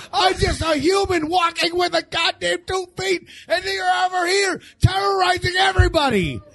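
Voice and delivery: high, shouted angry rant. Retold or imitated. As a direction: yell at the scooter rider in a rage. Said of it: "an Eddie Murphy routine".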